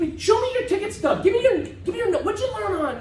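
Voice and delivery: high, pestering mock questions; mock-insistent grilling; mocking demand. Mocking demands for Disneyland notes.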